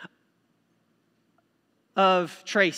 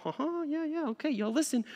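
dismissive brush-off; 7 semitones higher, 9 dB quieter than usual. Retold or imitated. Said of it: "look at teenagers and go".

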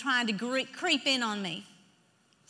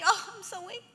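high, feeble mock complaint; mock weakness; 6 semitones higher than usual. Preacher acting out someone who feels weak, mock-feeble. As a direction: play someone pitifully weak. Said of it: "When you feel weak".

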